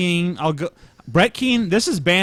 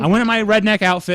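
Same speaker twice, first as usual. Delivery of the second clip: normal talking voice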